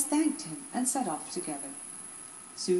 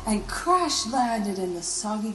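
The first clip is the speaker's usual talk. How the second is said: louder dramatic telling; dramatic narration; 2 semitones higher, 9 dB louder than usual. A narrator's reading. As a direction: keep the action loud. Loud dramatic narration continues.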